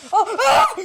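sharp high loud yelps of fright; startled cry. Real, not acted. Gamer caught by Granny, crying out in shock. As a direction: yelp as if hit.

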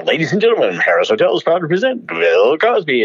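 high, ringing stage introduction; show announcer. Retold or imitated. Announcer introduces the star at the hotel, grand announcing. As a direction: announce the headliner, stretching up in pitch.

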